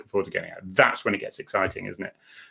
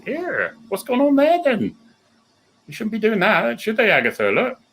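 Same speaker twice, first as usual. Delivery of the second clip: nosy passer-by; 11 semitones higher than usual. Retold or imitated. Mocks pedestrian with dog.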